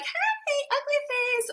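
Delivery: high, sing-song hello to the ugly phase; playful high-pitched hello to a nuisance; mock greeting. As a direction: greet it like an old friend, voice up high. Greeting the ugly phase mid-project.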